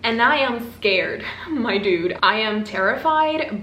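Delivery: ordinary talk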